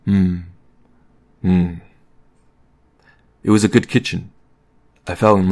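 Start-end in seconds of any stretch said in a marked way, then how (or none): 0.0-1.7 appreciative little murmurs; approving murmur. A narrator's reading. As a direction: murmur your approval, mouth closed. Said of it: "nodding and murmuring approvingly".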